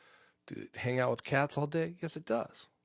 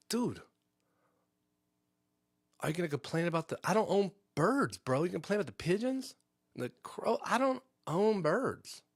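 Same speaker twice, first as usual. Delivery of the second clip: pitched up, mock disbelief at the cop; incredulous retort; 7 semitones higher than usual. To the cop at the door, incredulous high scoff. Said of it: "I'm like".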